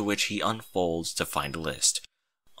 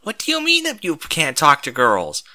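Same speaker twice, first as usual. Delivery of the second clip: puzzled pony; 5 semitones higher, 5 dB louder than usual. A narrator's reading. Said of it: "said a random pony".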